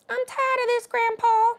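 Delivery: high squeaky put-on voice; high meme voice.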